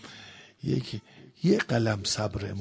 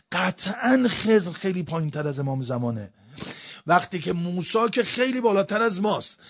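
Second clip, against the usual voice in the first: raised, higher preaching voice; emphatic preaching; 7 semitones higher, 7 dB louder than usual. Acted. Preacher challenging the congregation on keeping patience, emphatic preaching. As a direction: press the argument home, loud and high. Preacher drives home argument.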